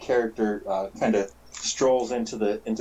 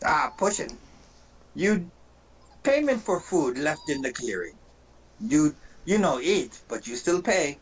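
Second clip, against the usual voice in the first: higher-pitched goblin voice in broken English; swaggering goblin; 4 semitones higher than usual. Acted. Goblin demanding payment for a potion, higher goblin voice. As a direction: play a haggling goblin, pitched up. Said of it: "he looks at you and he goes".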